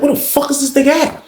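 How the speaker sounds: high-pitched, exasperated question; retold exasperation. Storyteller wondering where his friend went, retold exasperation. Retelling search for missing friend.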